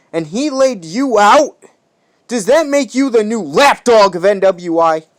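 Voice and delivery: loud, high mocking tirade; angry shout. Warden yelling at the beaten rival, angry shout. Taunting a rival about defeat.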